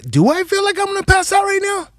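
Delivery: panicky inner voice. Retold or imitated. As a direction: squeak it like the thought scares you. Voicing panicked inner thought.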